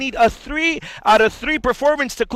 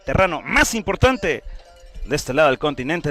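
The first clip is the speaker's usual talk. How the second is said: loud Spanish ring introduction; lucha libre announcer; 6 semitones higher, 6 dB louder than usual. Acted.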